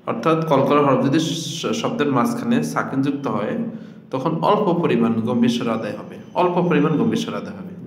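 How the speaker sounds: ordinary delivery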